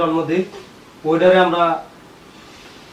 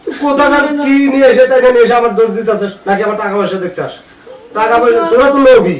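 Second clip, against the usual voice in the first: loud, raised-pitch tirade; angry berating; 3 semitones higher, 5 dB louder than usual. Acted. Husband accusing maid of greed, loud angry berating. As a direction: lash out to discredit them.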